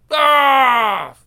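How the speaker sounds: a sharp cry of pain, loud and high; pained shout. Acted. Hero hit mid-fight cries out in pain. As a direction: yell as if struck.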